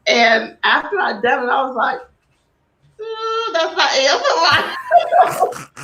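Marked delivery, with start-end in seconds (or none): 3.0-5.8 higher-pitched retold reaction, breaking into laughter; slow, drawn-out ooh, then laugh through the words; amused cringe. Guest recalling her failed ASMR video, laughing.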